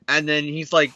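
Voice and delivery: ordinary talk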